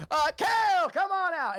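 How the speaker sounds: high, loud ringleader shout; big showman's call. Acted. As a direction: summon the act across the tent. Ringleader calling the performer out.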